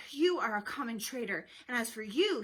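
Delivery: loud, emphatic denunciation; acted storybook anger; angry accusation. A narrator's reading. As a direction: round on them angrily. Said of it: "his face dark with anger".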